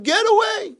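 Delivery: very high acted scream. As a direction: shriek him away as the cranky man. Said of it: "screaming at him".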